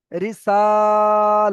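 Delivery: রিসালাতি with সা and লা held equally long; loud sustained সা and লা in রিসালাতি, short রি and তি; two equal elongations. Shown as a demonstration. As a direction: stretch the two middle syllables of রিসালাতি evenly. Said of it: "এই দুটো একই টান হবে".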